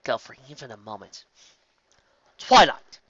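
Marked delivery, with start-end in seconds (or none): none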